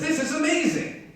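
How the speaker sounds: very high, squeaky burst of delight; excited chicken. Acted. Chicken gushing to the doubtful eagle about the farm, excited. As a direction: squeal it like an awestruck cartoon chicken.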